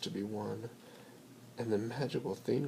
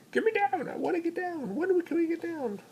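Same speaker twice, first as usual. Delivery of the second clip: much higher, loud squeaky little dog; little dog pleading; 8 dB louder than usual. Acted. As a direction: whine like a tiny dog stuck up high. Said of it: "he's saying".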